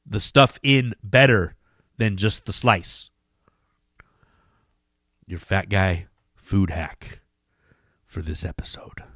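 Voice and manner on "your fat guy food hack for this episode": hushed build-up. Acted. Hushed segment lead-in.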